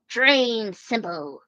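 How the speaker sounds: drawn out slowly; slow relishing repeat; slow savouring. Real, not acted. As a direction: stretch it out, savouring. Savours the words.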